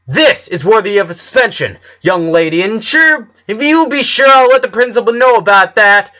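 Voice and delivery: loud, high, stern scolding; yelling teacher. A narrator's reading. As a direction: tear into the student, threatening suspension. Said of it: "was yelling her teacher".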